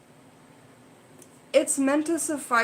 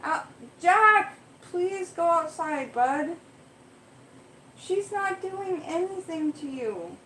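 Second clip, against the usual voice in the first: high, raised, pleading commands; pleading with cats; 7 semitones higher, slower than usual. Real, not acted. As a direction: beg the cat to go outside, raised voice. Pleading with cat Jack.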